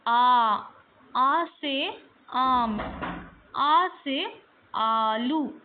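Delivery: teacher loudly drilling आ words. Shown as a demonstration. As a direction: drill it loud and slow for kids. Teacher revising vowels loudly.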